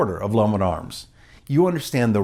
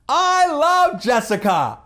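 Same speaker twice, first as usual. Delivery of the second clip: high, drawn-out mock holler; mock phone yelling; 3 dB louder, much slower than usual. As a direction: stretch out a loud declaration. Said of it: "the same volume level that he is".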